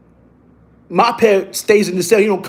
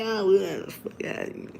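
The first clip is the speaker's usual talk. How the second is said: whispered brush-off; 11 dB quieter than usual. Retold or imitated. Dismissive retort, whispered.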